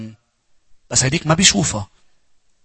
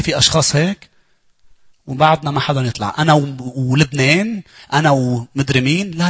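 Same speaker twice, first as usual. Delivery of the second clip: ordinary delivery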